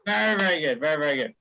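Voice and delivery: ordinary talk, nothing marked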